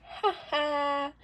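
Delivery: mock a teen's flat scoffing laugh; Summer Smith laugh. Retelling how a TV teen laughs at her mother's threat, sarcastically. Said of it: "Summer was just like".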